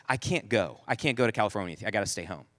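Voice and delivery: low, soft retold wife; hushed, low-pitched retold reply; low weary refusal. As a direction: refuse quietly from the pillow. Wife rolls over, refuses the trip.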